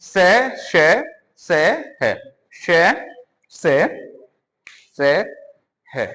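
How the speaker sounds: से, श, है sounded out one by one with a hissing breath; listing fricatives. Shown as a demonstration. Teacher names the four fricatives slowly, breathy. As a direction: let the air hiss through on each sound. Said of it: "कौन कौन सी".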